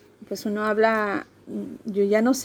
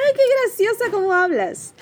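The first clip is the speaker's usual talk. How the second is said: much higher, amused cashier voice; mocking cashier; 11 semitones higher, 5 dB louder than usual. Madrid cashier teasing her accent, high amused coo. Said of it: "la cajera me decía".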